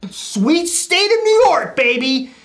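high, drawn-out showy exclamation; mock hype. Storyteller introducing next New York haircut, mock hype. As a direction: sing its praises slowly, high and over the top.

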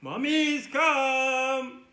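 drawn-out call to the moms. Pretend. Host waits for mommies to come, long loud call. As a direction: call them over, stretching each word.

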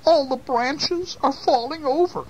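put-on very high old-lady voice; high-pitched storybook woe; worried old lady. A narrator's reading. Old lady laments sick bush.

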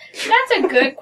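ordinary talk, nothing marked

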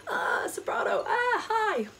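high, hammed-up panic; mock fear of a high note; mock panicked soprano. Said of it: "So it's psychological".

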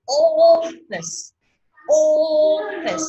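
"Office" with OF high, loud and long, FICE dropping; slow punched OF in "Office"; first-syllable stress. Shown as a demonstration. Teacher drilling stress on the first sound, loud long OF in "Office". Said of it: "Nó nhấn âm đầu đúng không?".

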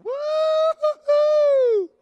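very high, loud, drawn-out whoop of joy; joyful whoop. Retold or imitated. Pastor imitating William's happy dad whooping in the car. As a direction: whoop it out slow like a delighted dad. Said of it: "his dad's over going".

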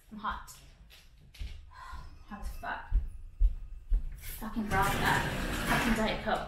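whispered, spent mutter; barely voiced, worn out; breathless mutter. Real, not acted. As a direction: sigh it out under your breath. Exhausted mutter, sighing.

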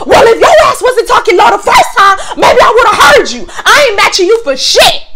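high, loud shout. Retold or imitated. Clerk shouting at the customer in front of everyone. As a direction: shout it across the store, fed up. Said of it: "I'm yelling at her".

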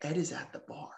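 quiet, secretive voice; hushed. Retold or imitated. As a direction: lean in and say it under your breath. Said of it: "my dad looks over at me and says".

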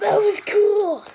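loud, slow, thrilled shout; excited shout. Real, not acted. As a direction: belt it out in delight. Excited outburst at the table.

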